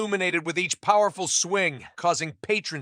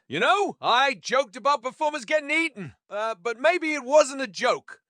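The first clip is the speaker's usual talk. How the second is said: pitched-up pondering with a hesitation; thinking aloud; 7 semitones higher than usual. A narrator's reading. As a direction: work it out aloud as you speak. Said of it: "Jerry, thinking aloud".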